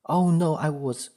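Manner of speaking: high, loud panicked excuse; acted fluster, fumbling for an excuse. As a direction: blurt it out, caught off guard. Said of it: "cannot find an excuse as your brain fogs".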